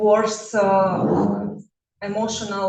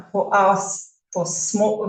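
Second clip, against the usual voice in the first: ordinary talk, nothing marked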